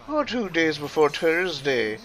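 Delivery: put-on English accent with upward inflection, "Thursday" with T for TH; lilting mock-Irish English accent, "Thursday" said with a T; Nikolai. Impersonating a character who can't say TH, lilting English accent. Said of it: "he has an upward inflection".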